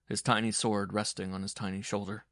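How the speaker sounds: usual speaking voice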